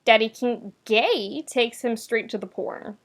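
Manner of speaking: usual speaking voice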